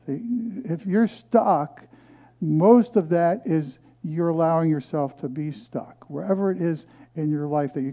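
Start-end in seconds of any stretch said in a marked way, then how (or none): none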